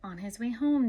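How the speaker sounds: usual speaking voice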